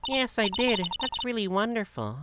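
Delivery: very high, sweet put-on voice; reply happily in a high character voice; delighted game character. Pearly agreeing it is wonderful, high and delighted.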